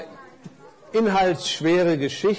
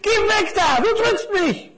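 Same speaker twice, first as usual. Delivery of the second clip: very high complaint of a squashed little parcel; indignant calling out; 4 dB louder than usual. A narrator's reading. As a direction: squeal at the one squashing you. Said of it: "rief das kleine Päckchen". (hoher, empörter Protestruf)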